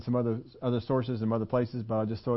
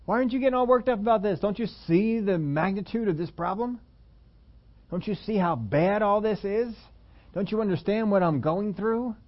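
high, whining, anxious pleas; worried person demanding why Jesus isn't upset, whining; anxious complainer; 8 semitones higher, 3 dB louder than usual. Retold or imitated. Hammed-up anxious whining.

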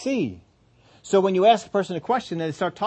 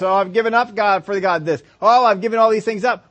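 complaining self-pity; 3 semitones higher, 5 dB louder than usual. Retold or imitated. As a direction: boast about everything you gave up. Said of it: "out of their mouth comes".

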